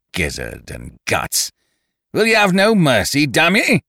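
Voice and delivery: high, furious bellow; roaring rage. A narrator's reading. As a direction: explode in desperate fury. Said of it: "he roared out".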